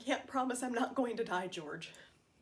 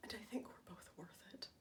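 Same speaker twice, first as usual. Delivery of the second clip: near-whispered, heartfelt close; hushed sincerity; 3 semitones lower, 14 dB quieter than usual. Acted. Wife telling George their marriage is worth it, hushed whisper. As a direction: let the voice drop to a whisper. Acted monologue, tender closing plea.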